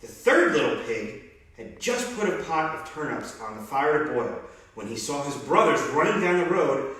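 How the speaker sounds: usual speaking voice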